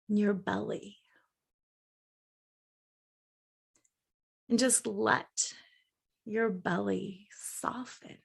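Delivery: soft, unhurried meditation voice with long pauses; guided meditation. Acted. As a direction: gently guide them into a calm daydream.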